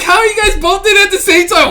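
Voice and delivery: high, loud disbelieving shout; yelling at two guys in one bunk; incredulous yell. Retold or imitated. Buddy yelling in disbelief at friends. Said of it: "I was like".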